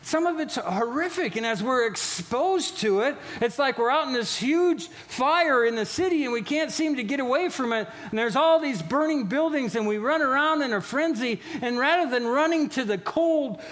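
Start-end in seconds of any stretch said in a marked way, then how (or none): none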